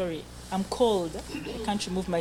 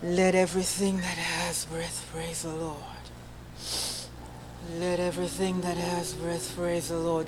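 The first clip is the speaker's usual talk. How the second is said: drawn out and lower, each word held; slow sustained phrase; 3 semitones lower, 6 dB quieter than usual. Shown as a demonstration. Choir leader demonstrating a phrase on one breath, slow and drawn out. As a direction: stretch the phrase across one long breath. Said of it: "Okay, so".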